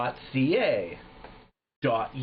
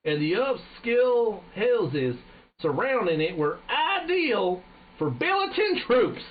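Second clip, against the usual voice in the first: slow, high-pitched American general drawl; exaggerated American accent; 7 semitones higher, slower than usual. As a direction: stretch it out as a hammy American general. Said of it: "It's a fucking American".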